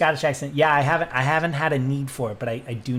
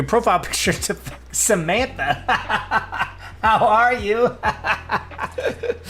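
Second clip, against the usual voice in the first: high, loud greeting broken by laughter; laughing; 7 semitones higher, 3 dB louder, slower than usual. Real, not acted. Streamer recognises friend's changed profile picture, laughing. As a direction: crack up while greeting a friend.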